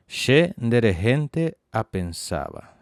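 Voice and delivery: re-read the corrected phrase clearly; slow corrected model. Shown as a demonstration.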